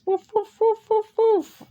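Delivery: high-pitched imitation dog barks; playful dog woofs. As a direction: woof like a little dog, high and quick. Imitating the dogs after mentioning them.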